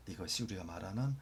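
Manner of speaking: usual speaking voice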